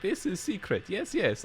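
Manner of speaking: high, lightly put-on Russian accent; a quick joking Russian-accented NPC impression; mock Russian accent. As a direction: imitate the quest giver in a loose Russian accent. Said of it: "she's kind of weirdly Russian".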